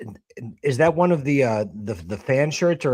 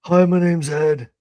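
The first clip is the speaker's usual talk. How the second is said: mock narrator; 5 semitones higher, 5 dB louder than usual. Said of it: "You want me to narrate it for you".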